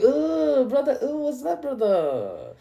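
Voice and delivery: a mock wail from a put-upon ally; mock European. Mocking Europeans eyeing US oil.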